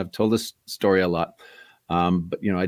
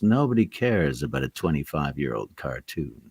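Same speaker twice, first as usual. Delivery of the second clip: lower, flat brush-off voice; dismissive agent; 6 semitones lower than usual. Retold or imitated. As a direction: shut the idea down curtly. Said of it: "And he goes".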